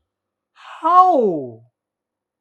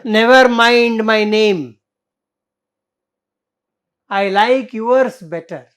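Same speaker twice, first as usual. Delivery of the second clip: firm slow retort; 6 dB louder than usual. Acted. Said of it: "You see the answer".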